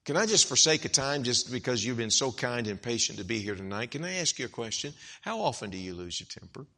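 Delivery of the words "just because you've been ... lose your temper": ordinary talk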